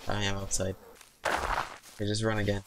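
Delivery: usual speaking voice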